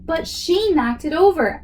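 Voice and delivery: a kid's mock furious yell. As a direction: protest to mom, outraged. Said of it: "I yell".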